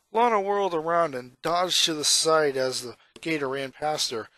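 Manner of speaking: ordinary delivery, nothing marked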